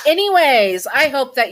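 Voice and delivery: a loud, high, sing-song "Anyways"; bright sign-off. Real, not acted. Host cheerfully wrapping up the story for viewers. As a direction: swing into the outro with a big lift.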